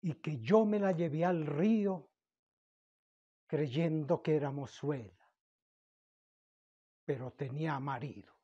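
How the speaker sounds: loud, dramatic declamation with long pauses between lines; poem recitation. Acted. Reciting Lorca's La casada infiel to viewers, impassioned declamation.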